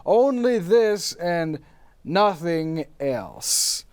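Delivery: slow, hushed poetic reading; dramatic poem recital. Acted. Poe's spirit reciting his poem to the host.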